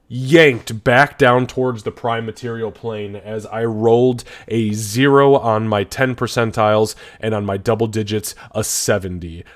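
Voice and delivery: normal talking voice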